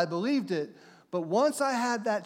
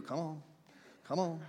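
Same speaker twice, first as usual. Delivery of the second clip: quiet, low fond coaxing; tender parent calling softly; 4 semitones lower, 9 dB quieter than usual. Acted. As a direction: call the little one gently. Father coaxing.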